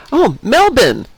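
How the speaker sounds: high "Oh", then Melbourne clipped to "Melbn"; Australian clerk catching on, "Mel-bn"; light Australian imitation. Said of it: "she looked at me and like".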